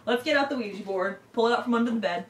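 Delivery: ordinary talk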